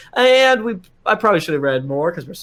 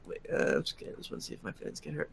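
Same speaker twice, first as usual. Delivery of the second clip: whispered aside; 18 dB quieter, much faster than usual. Real, not acted. Muttered aside after reading review.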